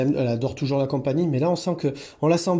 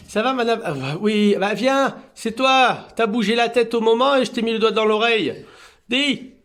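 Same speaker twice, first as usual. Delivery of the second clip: high, louder sing-song coo to a cat; cooing at a pet, lilting up; high pet talk; 8 semitones higher, 5 dB louder than usual. Real, not acted. Petting cat Annabella, cooing high and louder.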